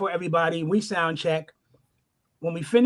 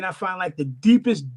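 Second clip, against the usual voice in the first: usual speaking voice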